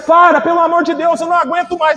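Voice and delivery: high pleading outcry, fading at the end; retold desperate shout. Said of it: "ela gritava".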